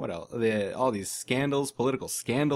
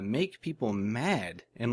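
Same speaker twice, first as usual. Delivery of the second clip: ordinary delivery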